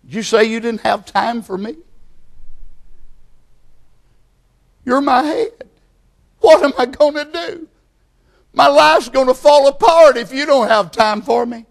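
high, pleading, anguished voice; distressed believer. Pretend. The believer pleading with God who has no time, high and anguished. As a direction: beg God not to abandon you. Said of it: "You'd say".

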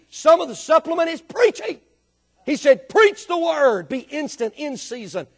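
loud emphatic preacher cadence; full-force preaching. Acted. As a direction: drive the scripture home from the pulpit.

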